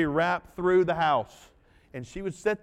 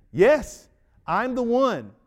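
insistent exclaim; 7 semitones higher, 9 dB louder, slower than usual. Acted. Beggar insisting he is the man.

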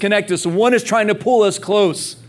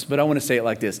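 dropped softer; 7 semitones lower, 6 dB quieter, much faster than usual. Real, not acted.

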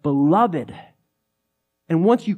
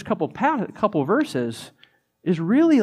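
ordinary talk

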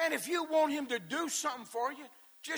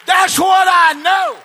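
shouted, rising gospel preaching, slowing on the big lines; preacher pounding it home to the church; preaching at full force; 4 semitones higher, 23 dB louder than usual. Acted. Preacher to the congregation, preaching at full shout.

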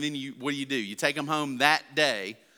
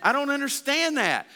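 higher, bewildered protest; baffled dad; 10 semitones higher than usual. Retold or imitated. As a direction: throw up your hands. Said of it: "And I'm like".

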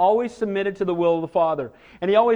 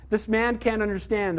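normal talking voice

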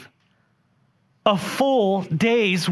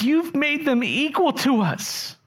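indignant workers' complaint; 9 semitones higher than usual. Acted. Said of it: "He says".

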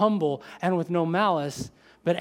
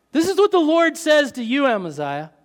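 higher, louder announcement of God's word; loud proclamation; 7 semitones higher, 6 dB louder than usual. Acted. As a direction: build up and proclaim it. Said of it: "You ready?".

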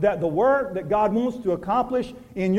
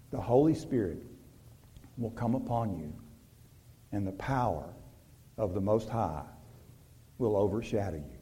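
reverent reading; 11 semitones lower, 12 dB quieter than usual. Acted. Reading the angel's promise.

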